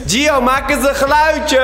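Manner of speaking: slow, loud, high call. Real, not acted. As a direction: call out to someone hidden. Calling out to hidden friend.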